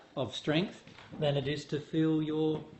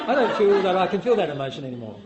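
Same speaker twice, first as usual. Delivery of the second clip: loud, rushed mock self-reproach; hammed-up rush of refusal; mock child; 4 semitones higher, 8 dB louder, faster than usual. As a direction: lightly act a child disowning a feeling, fast and higher. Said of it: "a little child going".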